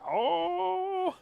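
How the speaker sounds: loud, high, held "oh" cry; hammed-up drawn-out wail as he reaches; imitated high-pitched holler; mock cry. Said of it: "He goes like".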